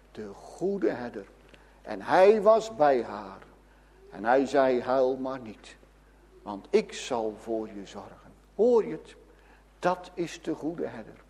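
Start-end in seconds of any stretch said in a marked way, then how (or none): 4.8-8.3 tender comfort. Acted. Jesus comforting a grieving widow.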